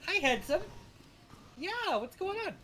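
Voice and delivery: high sing-song coo to a pet; sweet high pet voice. Real, not acted. Cooing to the cat.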